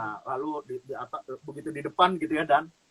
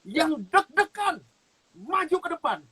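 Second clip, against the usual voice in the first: preacher's call; 10 semitones higher, 3 dB louder than usual. Retold or imitated. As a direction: call the nervous ones forward from the pulpit. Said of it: "Lalu dia bilang begini".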